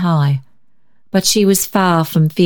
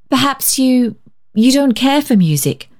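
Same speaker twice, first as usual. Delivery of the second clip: hesitant guess; 7 semitones higher than usual. A narrator's reading. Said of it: "she hazarded".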